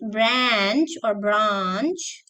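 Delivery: branch and branch said very slowly, one per accent; slow careful branch. Shown as a demonstration. Teacher slows down to correct a student's brunch. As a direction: slowly model both branches. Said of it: "not brunch".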